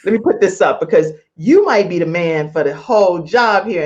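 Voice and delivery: ordinary talk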